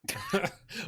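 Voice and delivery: high shriek of laughter. Real, not acted. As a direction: squeal with laughter. Laughing at Barry dies.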